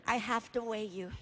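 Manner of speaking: gentle, quiet nurse voice. Acted. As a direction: deliver it softly as the nurse. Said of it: "I'm greeted by a nurse".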